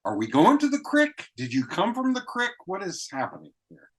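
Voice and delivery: very high, incredulous string of questions; bewildered questioning. Retold or imitated. Acting out confusion at rural speech.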